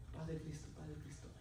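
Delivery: respuesta muy baja, sin ánimo; saludo abatido. Retold or imitated. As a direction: devuelve el saludo apagado, casi para ti. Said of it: "Yo en mí estaba abatido". (saludo decaído y muy suave)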